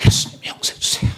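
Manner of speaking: breathy whispered imitation of a tearful prayer; whispered mock plea. Preacher mimics tearful repentance next to others, whispered. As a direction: whisper it as if weeping beside others. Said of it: "옆에서 같이 막 그랬거든요".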